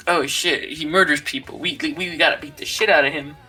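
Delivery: ordinary talk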